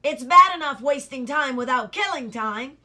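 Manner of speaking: loud indignant scolding; furious. Acted. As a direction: bellow in outrage.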